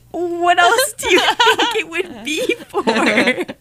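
high, slow, laughing mockery; laughing disbelief. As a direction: scoff through laughter at the obvious. Mocking the obvious question.

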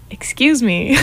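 American accent attempt. Shown as a demonstration. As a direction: try an American accent on request. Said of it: "Let's hear the American accent".

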